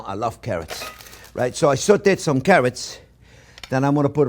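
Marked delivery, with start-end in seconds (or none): 0.0-0.8 soft. Shown as a demonstration.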